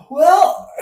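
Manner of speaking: loud, high groaning sigh into well; exasperated sighs. Acted. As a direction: sigh heavily in disbelief. Reacting to the absurd date.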